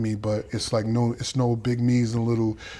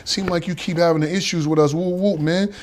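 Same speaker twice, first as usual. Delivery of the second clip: confronting; 6 semitones higher than usual. Retold or imitated. Older inmates confront him.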